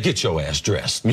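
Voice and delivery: low, slow, a touch of a gruff coach barking; coach's blunt order, lightly voiced. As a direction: bark it down like a coach ending the debate. Coach orders injured player.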